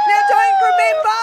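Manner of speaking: high, loud announcement; loud call. Real, not acted. Host calling out to the listeners over the crowd, loud announcement. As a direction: raise your voice over the crowd. Host calls for attention after win.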